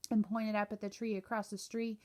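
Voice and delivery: ordinary delivery, nothing marked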